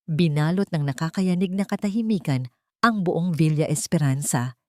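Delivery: plain reading voice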